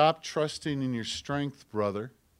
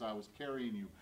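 hushed, much softer voice for God; soft whisper; 4 semitones lower, 14 dB quieter, faster than usual. Retold or imitated. Preacher voicing God softly, a hushed whisper. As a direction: drop to a near whisper. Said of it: "God whispers".

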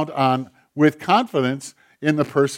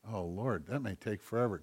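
softer and lower, a resigned sigh of a line; quiet mock groan; 6 semitones lower, 16 dB quieter than usual. Acted reply of a congregant.

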